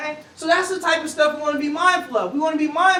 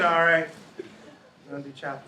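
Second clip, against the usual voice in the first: droning teacher; 8 semitones lower than usual. Retold or imitated. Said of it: "Teacher come in".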